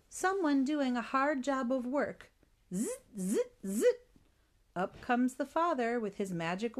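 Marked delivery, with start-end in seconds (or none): 2.7-3.8 short buzzing zzz strokes rising in pitch; rhythmic upward buzz of a saw; sawing noise. A narrator's reading. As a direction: imitate a handsaw with quick buzzes. Imitates a saw at work.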